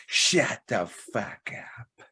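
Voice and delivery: a slow breathy hush on every word; slow whisper. Acted. Host teasing a viewer about his team, slow whisper.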